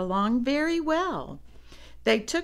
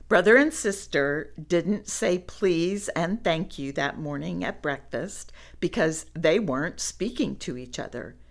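usual speaking voice

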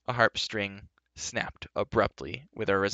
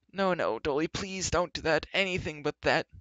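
wailing; 7 semitones higher than usual. Acted. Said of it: "wailed Gwistle".

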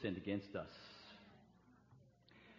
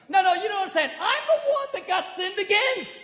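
higher, louder indignant protest, mocking the objector; 11 semitones higher, 10 dB louder than usual. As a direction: whine back that you're the victim, hammed up. Mimicking objector arguing back.

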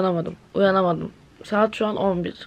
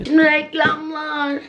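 slow, higher announcer voice; mock ad break announcer; 6 semitones higher, slower than usual. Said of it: "Şaka yapıyorum". (yavaş, tiz sunucu sesiyle reklam anonsu)

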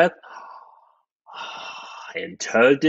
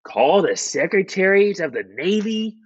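higher, mildly raised command; shouted order; 7 semitones higher than usual. A narrator's reading. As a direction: call out the list as an order. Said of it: "shouted President Taft".

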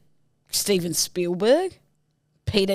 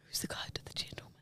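whisper; 24 dB quieter, much faster than usual. Real, not acted.

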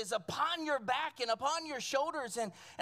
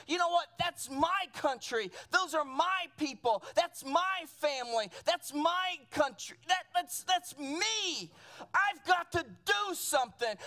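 raised, insistent preacher acting a call to act; Nehemiah's urgent resolve; 3 semitones higher, 3 dB louder than usual. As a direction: preach his resolve, pitched up. Acts Nehemiah's sudden resolve, preaching.

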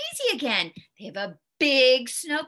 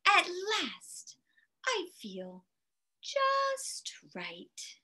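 drawn-out soft relief, lifting high on just; contented snowman; 5 semitones higher, 6 dB quieter, much slower than usual. A narrator's reading. Snowman finally comfortable after ice cream, soft contentment. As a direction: sigh it out softly, savoring each word.

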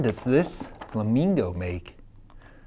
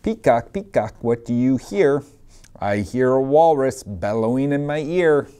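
lively sing-song picture-book reading; reading to children; 4 dB louder than usual. Acted. Teacher reading a picture book to her class, sing-song. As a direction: read it out to a class of little kids.

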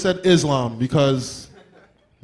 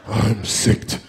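sick voice; 11 semitones lower, 4 dB quieter, slower than usual. Shown as a demonstration. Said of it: "rehearse your whole sick voice".

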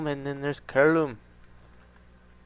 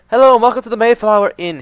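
much higher, louder, fast cheery host voice; innkeeper greeting; 10 semitones higher, 15 dB louder, faster than usual. Acted. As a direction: chirp the welcome like an eager innkeeper.

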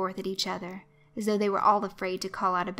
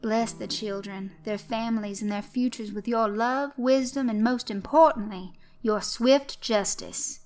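mock solemn prayer; 3 semitones higher, 5 dB louder than usual. Leads children in prayer.

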